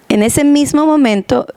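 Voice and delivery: ordinary talk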